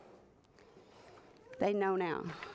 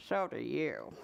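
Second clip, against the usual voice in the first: grumpy retort; 10 semitones lower, 4 dB quieter than usual. Acted. Hugh retorts to Lynn.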